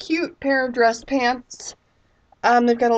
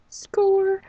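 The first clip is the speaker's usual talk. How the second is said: excited; 10 semitones higher than usual. Real, not acted. Cheering her cheap haul total.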